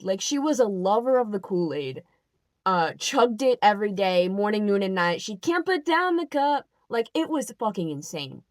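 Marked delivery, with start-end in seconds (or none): none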